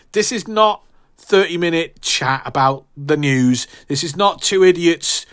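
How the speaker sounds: ordinary delivery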